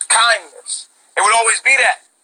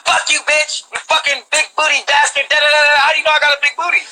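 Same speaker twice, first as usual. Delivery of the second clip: higher, hammed-up insulting commenter voice; mock a troll spitting insults in comments; raised, mocking hateful troll; mock hater rant; 6 semitones higher than usual.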